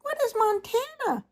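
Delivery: put-on very high, tiny chorus of baby swans; cygnets crying out. A narrator's reading. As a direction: squeak it like a startled cygnet. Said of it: "asked all the cygnets".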